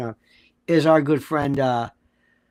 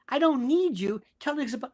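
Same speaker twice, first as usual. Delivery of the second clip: normal talking voice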